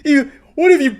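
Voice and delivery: very high, loud mock crying; hammed-up tearful tantrum; mock meltdown wail. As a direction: drag it out into a hysterical wail. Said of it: "he starts blaming the pizza place".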